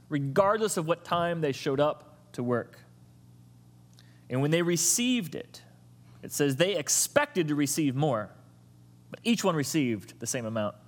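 usual speaking voice

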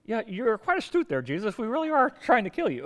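poor impression of a comic evil mastermind; Doctor Evil. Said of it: "That's a horrible Doctor Evil accent".

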